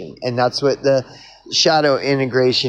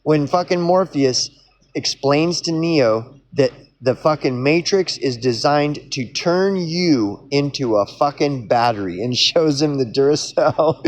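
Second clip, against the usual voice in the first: ordinary talk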